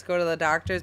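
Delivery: ordinary talk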